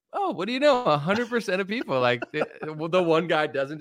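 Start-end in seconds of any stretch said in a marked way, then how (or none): 0.1-2.0 higher, hammed-up amazement; mock fake surprise. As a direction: act shocked at the result. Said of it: "You're like".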